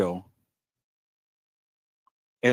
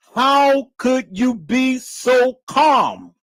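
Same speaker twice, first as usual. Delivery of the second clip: slow, loud, high mock yell; mock shouted question; 9 semitones higher, 4 dB louder, much slower than usual. Mimicking the host's demand in a slow loud yell. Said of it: "she said".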